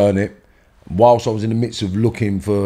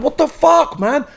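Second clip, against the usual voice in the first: very high, loud cry of outrage; retold furious outburst; 3 dB louder, slower than usual. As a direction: yell it as they take the gun. Mates grab the gun, retold.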